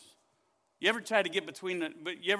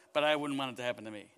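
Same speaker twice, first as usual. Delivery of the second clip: usual speaking voice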